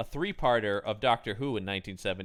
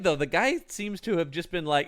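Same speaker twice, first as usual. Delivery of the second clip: ordinary delivery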